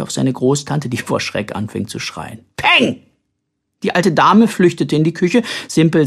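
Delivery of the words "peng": sudden high, sharp bang; pretend gunshot. A narrator's reading. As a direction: fire the word like a toy pistol. Child fires toy pistol.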